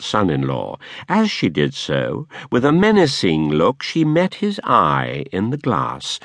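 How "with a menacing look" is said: ordinary delivery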